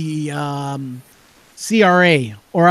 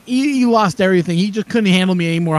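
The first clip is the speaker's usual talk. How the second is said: normal talking voice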